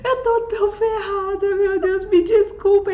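high, loud tearful apology; crying apology. Retold or imitated. Telling the father she is pregnant, crying. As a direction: sob out the apology.

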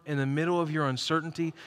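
ordinary talk

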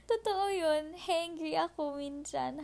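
giggly and loud, still laughing. Real, not acted. Laughing at own word.

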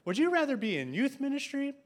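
put-on older lady asking the pastor's wife in the van; Miss Mary's voice. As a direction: lift the voice to play Miss Mary. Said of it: "I'm not gonna try to do her, her voice".

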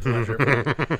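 drawn-out laughing repeat. Real, not acted.

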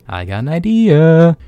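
high, slow, dramatic announcement; sudden idea. Pretend. Playing up his story idea, grandly slow. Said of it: "awesome short story".

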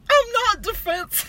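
high, slow indignant reply; quoting a character. Retold or imitated. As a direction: drag it out, high and offended. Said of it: "and she goes".

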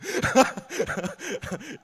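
high shouted laugh. Real, not acted. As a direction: burst out laughing. Laughs at own Pepsi joke.

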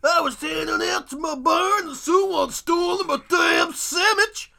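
high, loud, slow cowboy yell; old prospector. Pretend. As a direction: holler like an outraged old prospector. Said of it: "the kind of people who say".

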